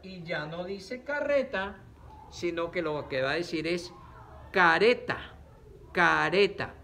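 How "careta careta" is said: loud "careta" with one light tap for the r; R suave. Shown as a demonstration. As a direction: say "careta" clearly, single-tap r, no trill. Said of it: "nos va a sonar suave". ("careta" en voz fuerte, con erre simple sin vibrar)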